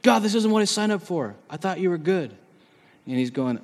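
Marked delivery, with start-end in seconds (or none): 0.0-2.3 mildly aggrieved, higher-pitched protest; mock indignant whine; mad complaint. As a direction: act out a believer griping at God. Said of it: "mad about it".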